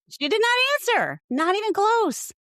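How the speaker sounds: high, loud disbelief; can't believe she dodged it; incredulous emphasis. Real, not acted. Incredulous retelling of dodged question.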